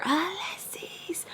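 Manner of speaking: drawn-out, high, hushed imitation of a yell; mock distant yell. Man calling her from downstairs, hushed mock yell. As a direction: stretch the name in a whispered mock shout. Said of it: "like, really loud".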